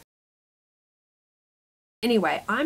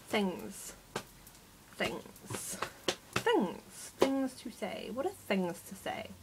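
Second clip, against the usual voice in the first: British accent try; 9 dB quieter, slower than usual. Shown as a demonstration. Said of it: "I'm also not British or [name]".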